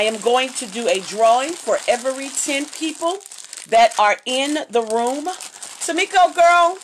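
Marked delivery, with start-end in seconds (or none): 5.3-6.8 calling out. Real, not acted. Calling out to a viewer.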